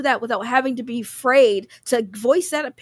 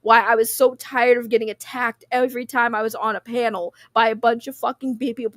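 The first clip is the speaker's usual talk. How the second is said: normal talking voice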